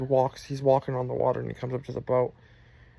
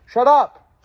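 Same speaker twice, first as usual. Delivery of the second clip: loud, high yell of shut up; sudden shout; 11 semitones higher, 14 dB louder than usual. Real, not acted. Telling of being laughed at, breaks into a sudden shout. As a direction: break off and yell sharply, much higher and louder.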